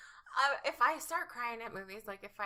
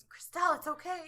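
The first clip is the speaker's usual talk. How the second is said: a touch of an exasperated mom, higher and louder; mom's reassurance; 8 semitones higher, 8 dB louder than usual. Retold or imitated. Mom to her bawling daughter after Titanic, mock reassurance. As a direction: lightly voice a mother soothing her child. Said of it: "My mom was just like".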